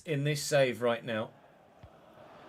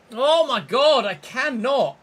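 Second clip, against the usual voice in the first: much higher and louder, at the end of his tether; frustrated yell; 9 semitones higher, 11 dB louder, slower than usual. Real, not acted. Gamer losing possession again, frustrated yell. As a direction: blow up in exasperation.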